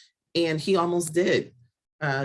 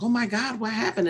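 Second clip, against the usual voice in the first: high-pitched mock panic, not shouted; retold panicked cry; 8 semitones higher than usual. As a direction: imitate a frightened cry at normal volume. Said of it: "I'm screaming, I'm crying".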